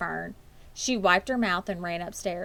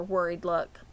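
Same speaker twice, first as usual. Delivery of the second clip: ordinary delivery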